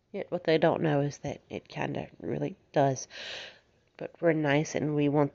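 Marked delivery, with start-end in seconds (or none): none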